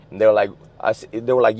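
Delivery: ordinary talk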